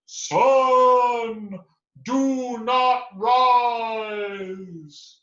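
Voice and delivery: high, loud, slow command to the sun; commanding shout. Acted. Bear shouting an order at the rising sun. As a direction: bellow it out to the sky. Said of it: "and she shouted".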